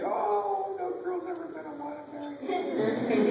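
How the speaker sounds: high, loud wail of despair; despairing child. Acted. Shamed boy sure no girl will marry him, wailing. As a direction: wail it like a doomed little boy.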